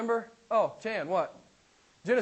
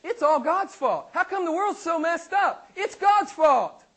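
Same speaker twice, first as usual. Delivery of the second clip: much higher, louder, indignant griping; mock the skeptic blaming God, pitched way up; unbeliever's complaint; 9 semitones higher, 6 dB louder than usual. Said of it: "this is one of the things I always hear".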